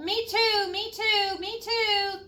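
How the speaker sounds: high, eager little voices; piped in. Acted. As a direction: chirp it up high, one after another. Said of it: "three more voices piped in".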